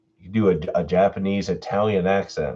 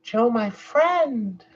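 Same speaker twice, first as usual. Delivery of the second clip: high character voice; much slower than usual. Acted. Doing voices on request.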